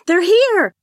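high, loud call to someone in the house; excited shout. A narrator's reading. Charlotte shouts to her father as guests arrive, excited shout. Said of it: "she shouted to her father".

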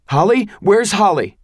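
loud, high frantic calling; panicked shout. Acted. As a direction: call out for her in panic. Husband panicking on phone.

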